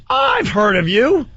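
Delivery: high, stretched-out imitation of a pleased star; retold delighted recognition. Star recognises him, retold as great moment.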